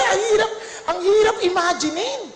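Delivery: ordinary talk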